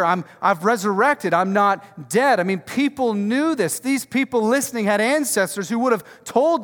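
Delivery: loud, driving sermon build; full-force preaching. Acted.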